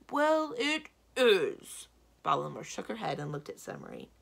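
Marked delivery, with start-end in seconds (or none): 0.1-1.9 loud, high, drawn-out insistence; stubborn giant. A narrator's reading. Giant arguing with his wife, stubborn insistence. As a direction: dig in loudly, stretching each word.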